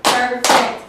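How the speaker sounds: Perfect said loud, split into two beats; counting syllables. Shown as a demonstration. Teacher claps out syllables for her pupils, loud. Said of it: "Let's count that one out".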